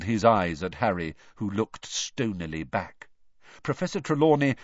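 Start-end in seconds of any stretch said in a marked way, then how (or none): none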